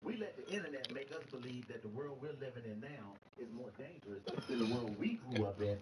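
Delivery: ordinary talk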